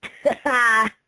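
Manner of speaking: loud gleeful cackle of triumph; snickering laugh. A narrator's reading. Prankster mare laughs at her pantsed friends, snickering. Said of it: "snickered Vinyl".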